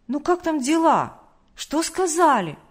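put-on high, loud, eager little boy; joyful child asking. A narrator's reading. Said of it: "Младший Тимошка радостно спросил". (радостно, высоким детским голосом)

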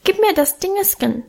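put-on high, pleading toddler voice; high little boy voice. A narrator's reading. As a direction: beg like a tiny child, high-pitched. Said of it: "bat mit seinem hohen Stimmchen".

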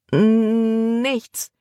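high stammer on a drawn-out first sound. A narrator's reading. Libby caught by Caleb, stammering her denial. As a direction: stall on the N, caught out. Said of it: "stotterte Libby".

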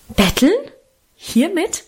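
indignant, rising questions. A narrator's reading. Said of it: "entgegnete Mutter entrüstet".

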